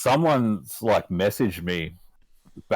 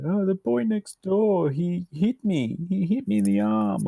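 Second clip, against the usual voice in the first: mock crying child; 11 semitones higher than usual. Crying niece tells her uncle the boy next door hit her, mock tearful whine. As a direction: imitate a tearful kid telling on someone. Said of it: "she's all crying and stuff".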